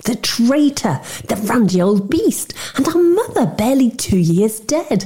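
high-pitched daughterly outrage; outraged. A narrator's reading. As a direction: pour out indignation at a father's betrayal. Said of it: "The daughter me is outraged".

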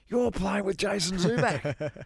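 high, slow, wide-eyed "you're playing with him"; awed warning. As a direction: imitate the pros' hushed awe, pitched well up. Pros coming up to warn him.

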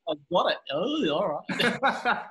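high, loud, hammed-up inner voice, fast then slow and laughing; mock bravado then laughter. Guest retelling meeting the host, loud mock bravado. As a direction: psych yourself up, then crack up laughing. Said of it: "I was like".

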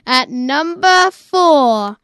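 slow drawn-out announcer reveal; dramatic countdown. Pretend. Kid host to the listeners, slow announcer reveal. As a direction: stretch it out like a game show host.

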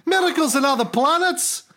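put-on high, exasperated rant in Scottish accent; Scottish God. God griping about endless miracle requests, exasperated Scottish accent. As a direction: complain like an overworked, put-upon Scotsman. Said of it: "He must be Scottish".

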